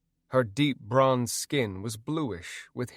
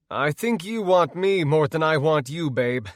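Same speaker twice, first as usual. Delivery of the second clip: louder, cocky bravado; bravely; 4 semitones higher, 5 dB louder than usual. Acted. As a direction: push it out with bold confidence. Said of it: "I said bravely".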